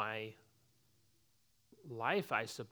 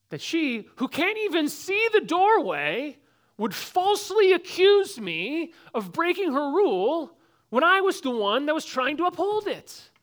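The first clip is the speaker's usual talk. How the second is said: much higher, louder, slow indignant protest; indignant outrage; 14 semitones higher, 13 dB louder than usual. Retold or imitated. A falsely accused boy protesting his teacher, high indignant outrage. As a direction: relive the unfair accusation at full pitch. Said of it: "The injustice".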